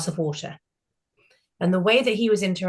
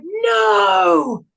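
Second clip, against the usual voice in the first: high, loud, frantic no; panicked yelp to stop the waiter; panicked shout; 11 semitones higher, 8 dB louder than usual. Retold or imitated. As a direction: yell it to stop him. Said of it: "I went".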